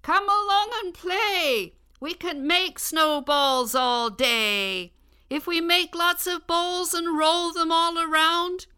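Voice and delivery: put-on high, bright child calling out; calling to friends. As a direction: call friends out to play. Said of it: "She called to her friends".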